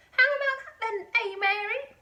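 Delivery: put-on high, loud old woman's shout; Grandma shouting. A narrator's reading. Grandma shouting from the roof at Mary. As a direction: bellow it down from the rooftop. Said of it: "Grandma shouted".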